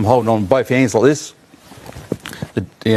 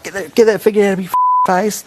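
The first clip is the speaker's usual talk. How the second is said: high, fast mock protest; imitating; 10 semitones higher than usual. Rescuer pushing bloody fingers away, high fast protest. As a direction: brush off a hand in panic. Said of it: "He's like".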